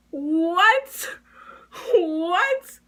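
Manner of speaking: high, drawn-out rising question; shocked disbelief. Retold or imitated. Student hearing the fake account accusation, shocked and incredulous. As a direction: stretch it upward in utter disbelief.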